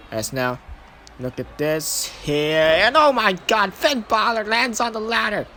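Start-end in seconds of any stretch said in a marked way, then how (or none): 2.6-5.4 slow, loud stretched "oh my god"; excited outburst. Real, not acted. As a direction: draw out the shock as the big spot lands. Balor lands on the ladder.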